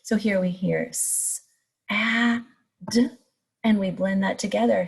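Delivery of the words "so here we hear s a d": "s", "a", "d" said apart with pauses; segmented sounds. Shown as a demonstration. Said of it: "say the sounds in sad".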